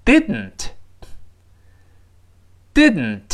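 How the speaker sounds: "didn't" said slowly, the dn released through the nose; slow nasal plosion. Shown as a demonstration. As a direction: hold the tongue after "did" and push the n through the nose. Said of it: "我们跟老师慢慢读一下". (慢读 didn't，鼻腔爆破)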